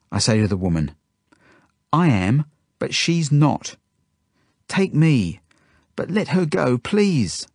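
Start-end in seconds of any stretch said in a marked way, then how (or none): 1.9-7.5 high-pitched, earnest begging; pleading. A narrator's reading. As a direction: plead for a friend's release. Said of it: "I look at the woman pleadingly".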